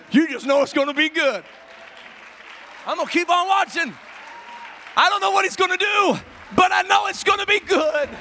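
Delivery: loud, driving sermon climax; full-force preaching. Acted. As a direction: preach with rising fervor.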